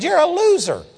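high-pitched taunt; re-enacted scornful jeer; laying it on. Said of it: "I just began to lay it on this guy".

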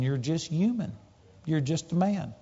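softly voiced doubting thought; hushed, understated quote of doubt; quiet inner doubt. Senses voice doubt against faith.

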